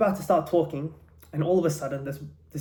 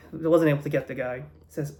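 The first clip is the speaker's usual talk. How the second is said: ordinary delivery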